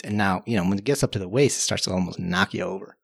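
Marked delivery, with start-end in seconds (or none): none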